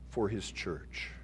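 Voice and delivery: normal talking voice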